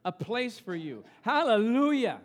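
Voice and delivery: full-voiced sermon delivery; preaching. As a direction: proclaim it to the congregation. Preacher quoting scripture loudly.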